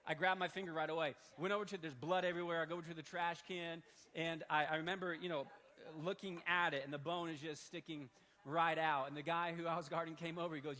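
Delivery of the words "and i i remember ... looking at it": normal talking voice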